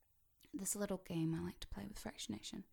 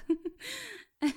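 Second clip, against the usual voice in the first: high, loud burst of laughter; amused laugh; 12 semitones higher, 11 dB louder than usual. Real, not acted. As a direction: laugh at your own trick. Amused after waking listener.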